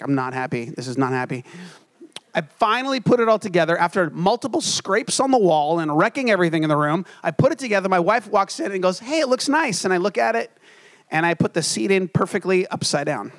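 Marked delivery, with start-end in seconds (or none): 0.0-1.5 lower, softer sulky grumble; half-joking low grumble; grumpy mock sulk. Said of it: "getting really mad". 9.0-9.8 wife's cheerful compliment. Retold or imitated. Wife praises the finished futon.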